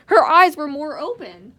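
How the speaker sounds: very loud, high exclamation, drawn out; excited shout. Real, not acted. Repeats discovery about the eyes.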